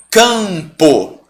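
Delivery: CAMPO drawn out, first vowel nasal; slow CAMPO with a long nasal A; nasalized demo. Shown as a demonstration. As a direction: stretch CAMPO sound by sound, silent M. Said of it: "Quantos sons, quantos fonemas".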